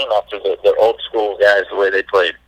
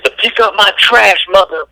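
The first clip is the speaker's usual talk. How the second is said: high, drawn-out mock retort; comeback punchline; 10 semitones higher than usual. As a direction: deliver the comeback high and slow. Acted punchline of heckler comeback.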